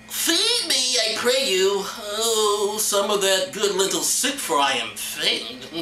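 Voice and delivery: high wail sinking as strength fades; faint, pleading character voice. Acted. Hungry Isaiah begging Jacob for soup, hammed-up faint plea. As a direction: beg dramatically, slowing into a swoon. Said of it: "that's too my Jacob voice".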